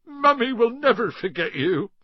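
put-on a giant crying over his baby dragon; sobbing farewell. A narrator's reading. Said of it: "Hagrid sobbed".

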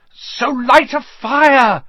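slow, loud, high choked cry; choking. Acted. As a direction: force it out while being strangled. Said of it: "Harry choked".

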